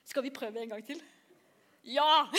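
ordinary delivery

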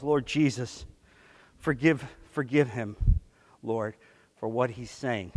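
low, soft plea to God; quiet prayer. Retold or imitated. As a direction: pray quietly for him. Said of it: "And Norm goes".

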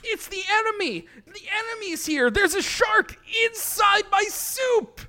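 high panicked yelling; alarmed shout. Acted. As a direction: scream the warning to everyone. Acts character spotting enemy.

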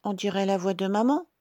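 high, quick little-goat wonder; exclaiming kids. A narrator's reading. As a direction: wonder aloud as little goats. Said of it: "s'exclament les chevreaux". (chevreaux étonnés, voix aiguë)

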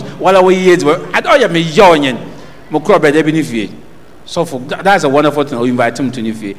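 normal talking voice

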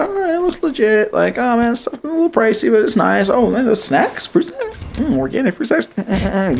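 high put-on voice, musing over snacks; acted browsing delight. Acting out walking round the store, pleased high-pitched musing. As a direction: think aloud in a high, playful murmur.

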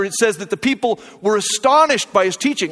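ordinary talk

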